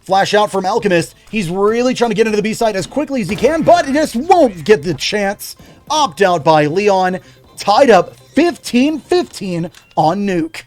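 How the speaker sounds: energetic esports caster voice; play-by-play commentary. Acted. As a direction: call the round like a live caster. Casting live esports match.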